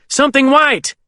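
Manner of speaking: very high shouted sighting; urgent shout. A narrator's reading. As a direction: shout it out to the pilot. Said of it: "I shout".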